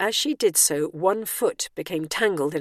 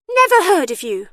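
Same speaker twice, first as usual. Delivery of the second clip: high, loud diva snub; dismissive starlet; 12 semitones higher, 10 dB louder than usual. A narrator's reading. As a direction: snub them loudly in a high voice. Starlet snubs Bernice.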